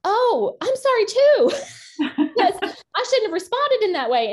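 high, loud gushing imitation of a wife apologizing; wife's eager apology. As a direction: voice her apology high and loud, breaking into laughter. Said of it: "And she was like".

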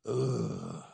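low, whiny groan of dread; reluctant groan. Acted. Husband told to go say he's sorry, low grudging groan.

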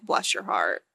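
very low, snide; a snide low Southern put-down; sarcastic bless your heart. Pretend. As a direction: drop low and dripping with judgment. Voicing the judgy phrase.

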